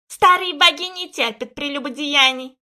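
put-on high, loud cawing old woman; croaking crone. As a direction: caw it out like a crow. Guardian warns.

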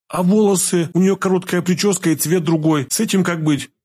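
put-on high girlish questioning voice. A narrator's reading. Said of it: "продолжала задавать вопросы девушка". (девушка расспрашивает высоким голосом)

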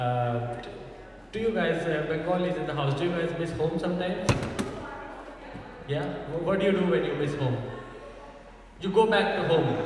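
usual speaking voice

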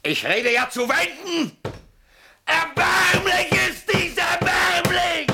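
slow, high, loud cry of contempt; drawn-out, high, furious shout; despairing shout. Acted. Raging that nobody listens.

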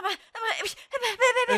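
high, stammering protest; sputtering protest. Acted. Hermine protests in shock.

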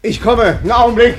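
loud, high call through the closed door. Acted. Barber calls through the locked door to his customer, loudly.

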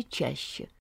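plain reading voice

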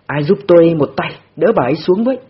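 loud urgent call; calling out for help. A narrator's reading. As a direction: shout for help, straining. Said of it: "vội hô lên với mọi người". (gọi to cầu cứu)

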